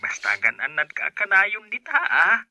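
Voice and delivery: normal talking voice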